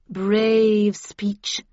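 loud, drawn-out mock praise; sardonic. A narrator's reading. Heathcliff answers Linton's complaint, sardonically. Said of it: "replied Heathcliff sardonically".

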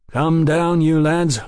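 brisk, loud call to come down. A narrator's reading. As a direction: policeman calling men down from a wagon. Sergeant calls to men in wagon.

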